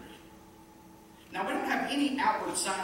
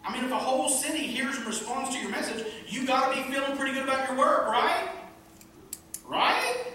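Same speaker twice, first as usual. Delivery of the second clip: building excitement; 7 semitones higher, 5 dB louder, faster than usual. Acted. Preacher building to rhetorical question.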